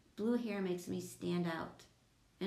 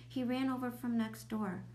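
ordinary delivery, nothing marked

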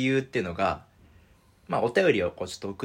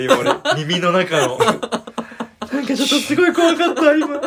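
high, loud, laughing disbelief; startled laughter; 13 semitones higher, 10 dB louder, much slower than usual. Real, not acted. Host reacting to a friend's eerie newborn cry, laughing and startled. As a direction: laugh loudly through the shock.

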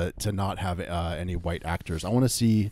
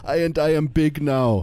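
put-on loud, high Rastafarian accent; rasta speech; 7 semitones higher, 6 dB louder than usual. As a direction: Jamaican patois voice, pitched up. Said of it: "a Rastafarian accent the whole time".

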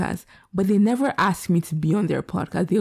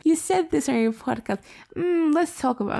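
much higher mock fan voice, prying; 9 semitones higher than usual. Mocking a fan who confronts her about the podcast, much higher prying voice. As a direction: pitch it way up like a nosy listener. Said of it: "Don't come at me in person and be like".

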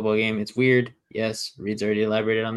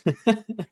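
high laugh; 11 semitones higher than usual. Real, not acted. Laughing at own bit.

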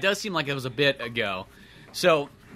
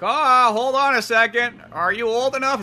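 high, slow doubting clerk; suspicious clerk; 10 semitones higher, 5 dB louder than usual. Retold or imitated. Clerk checking buyer's age, slow high doubt. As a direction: stall him with suspicion.

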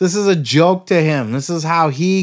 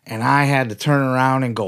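ordinary delivery

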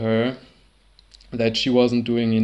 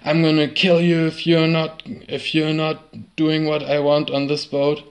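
a retold rage at a higher pitch; retold screaming; 4 semitones higher than usual. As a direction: shout it back the way the angry captain did. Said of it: "Rockne just kept on screaming".

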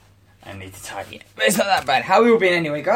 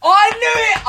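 triumphant shout; 8 dB louder, slower than usual. Real, not acted. Triumphant outburst.

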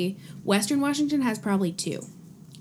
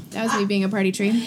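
ordinary delivery

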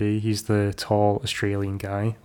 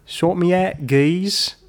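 higher-pitched mock Cockney; Cockney accent; 8 semitones higher, slower than usual. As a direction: throw out a hammed-up Cockney line. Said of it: "That was Cockney".

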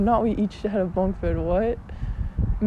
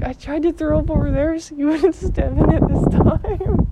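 mock sister voice; 5 semitones higher, 4 dB louder than usual. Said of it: "she probably didn't talk like that".

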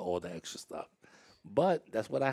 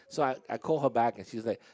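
normal talking voice

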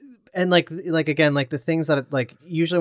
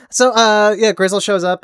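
usual speaking voice

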